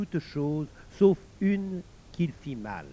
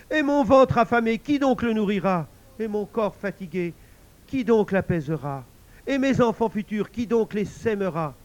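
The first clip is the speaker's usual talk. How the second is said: pleading; 6 semitones higher, 7 dB louder than usual. Acted. Husband pleads with God.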